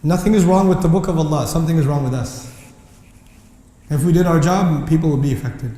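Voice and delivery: usual speaking voice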